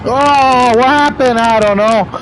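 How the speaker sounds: high, loud panicked yelp; startled yell. Real, not acted. Streamer yelping at the game as his car spins, startled shout.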